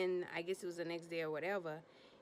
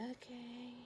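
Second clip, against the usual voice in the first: tiny, soft, higher okay; stunned small voice; 4 semitones higher, 11 dB quieter than usual. Retold or imitated. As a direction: imitate her taking bad news very quietly. Megan hears he's gone four months.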